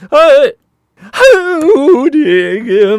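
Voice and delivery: high, loud, very slow sob-wail in a put-on old woman's voice; hammed-up tearful wail; mock crying grandma. Grandma breaking down again over her granddaughter, loud crying wail.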